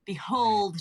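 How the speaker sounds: normal talking voice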